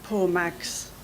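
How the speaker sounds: ordinary delivery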